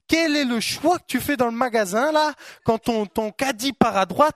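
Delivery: high, loud, rising sermon cry; preaching at full force. Acted. Preacher to the congregation, rising full-force sermon.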